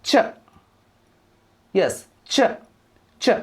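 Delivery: ch sounded out as a short "chuh"; teacher repeating ch as an isolated sound; digraph sound demo. Shown as a demonstration. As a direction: say ch as one blended syllable, spaced out. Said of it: "giving a new sound".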